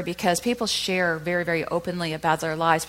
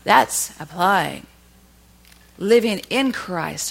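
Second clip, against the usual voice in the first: louder, slow, deliberate; slow emphasis; 5 dB louder, much slower than usual. Acted. Preacher landing the lesson to the congregation, slowly. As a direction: land each word heavily. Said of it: "slowed emphatic point".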